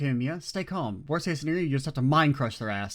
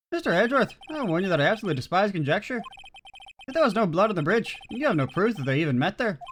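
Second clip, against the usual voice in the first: higher, brisk judge scolding; 7 semitones higher, 3 dB louder, faster than usual. Acted. Judge scolding Edgeworth, higher and quick. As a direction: warn the prosecutor sharply, a bit high.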